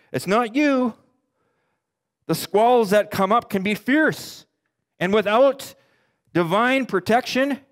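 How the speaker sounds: impassioned sermon build; preaching at full force. Acted. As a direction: drive the danger home to the congregation. Preaching about life's storms.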